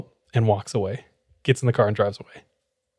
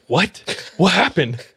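sharp, high startled questions; surprised; 7 semitones higher, slower than usual. Retold or imitated. Narrator hearing the thief was caught, high startled surprise. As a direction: shoot up in pitch, caught off guard.

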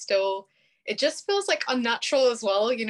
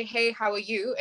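everyday English British accent; normal British accent. Shown as a demonstration. Brit demonstrating her usual greeting, normal British accent. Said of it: "in a very normal English British accent".

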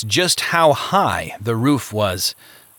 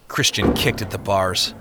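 ordinary delivery, nothing marked

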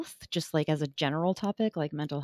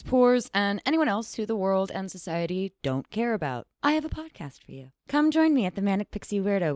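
ad read; 2 semitones higher than usual. Acted. Reads podcast promo.

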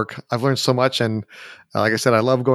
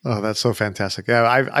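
ordinary delivery

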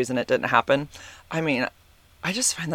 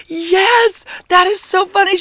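much higher, louder giggly mom voice; imitating mom; 7 dB louder, slower than usual. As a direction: play your amused mom, squealing with delight. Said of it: "she's like".

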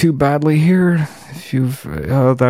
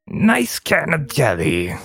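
goblin in character. Acted. Streamer testing a substitute word, hammed-up goblin voice. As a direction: savour it like a creepy goblin. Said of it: "Even in character".

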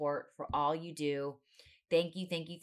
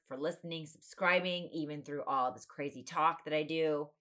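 ordinary talk

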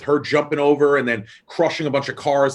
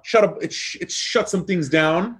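put-on Jamaican accent; 6 semitones higher than usual. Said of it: "which is my Jamaican accent".

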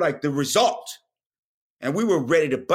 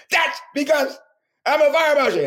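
much higher, louder character shout; Fire Marshal Bill shout; 12 semitones higher, 6 dB louder than usual. Retold or imitated. Comic retelling a sketch, shouts as Fire Marshal Bill. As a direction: bellow it as the crazed fire marshal. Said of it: "he goes".

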